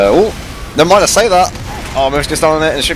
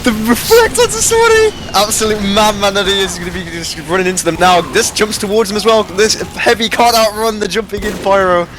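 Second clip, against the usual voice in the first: rising hype call; excited; 8 semitones higher than usual. Real, not acted. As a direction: shout the big play excitedly. Caster hyped by a big play.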